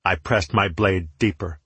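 ordinary delivery, nothing marked

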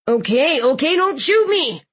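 high scared squeal. A narrator's reading. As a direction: squeal it out in panic. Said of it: "Jimmy squealed the final bit".

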